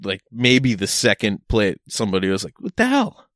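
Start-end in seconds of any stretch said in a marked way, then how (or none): 2.6-3.2 high, fast, mock-polite Kenyan host voice; polite accent. Host scolding the guest for drying dishes, high polite accent. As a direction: imitate a polite local exclaiming in surprise. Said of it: "But in a very polite accent".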